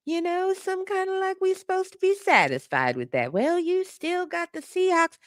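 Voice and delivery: high-pitched mocking mimicry; mock placating voice. Streamer mimicking those who say we should be satisfied, mocking. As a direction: put on a high voice for the placating line.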